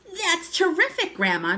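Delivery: put-on high, loud boyish amazement; delighted cry. A narrator's reading. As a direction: cry out in admiration. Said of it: "George cried".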